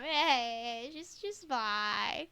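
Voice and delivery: normal talking voice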